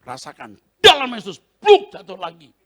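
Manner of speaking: loud, high strike in Jesus' name; forceful rebuke. Retold or imitated. Preacher striking the spirit, very loud. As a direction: hammer the words down.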